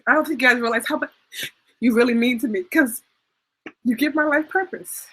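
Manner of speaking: tearful and high, words breaking up; crying. Real, not acted. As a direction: say it through real tears. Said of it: "I can't believe I'm crying".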